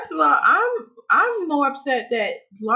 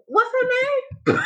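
laughing; 13 semitones higher, slower than usual. Real, not acted. Laughing disbelief at the story.

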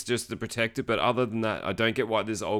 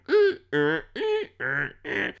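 rhythmic creaky van noises; imitated bouncing van; 5 semitones higher, much slower than usual. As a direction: mimic a squeaking suspension with short hums. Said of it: "you can just hear the van going".

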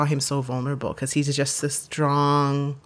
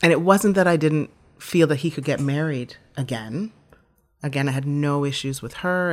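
usual speaking voice

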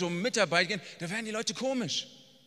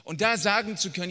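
ordinary talk, nothing marked

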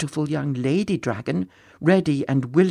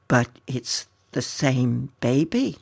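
slow, lower, bewildered dragon voice; puzzled; 4 semitones lower, slower than usual. Acted. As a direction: sound baffled, drawing the words out. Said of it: "very puzzled".

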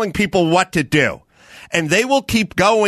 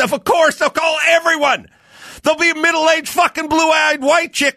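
shouting; 7 semitones higher than usual. Real, not acted. Ranting about being called racist.

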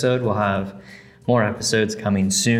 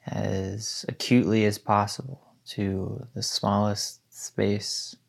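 guided meditation. Acted. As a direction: guide them gently into stillness.

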